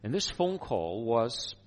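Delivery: ordinary talk, nothing marked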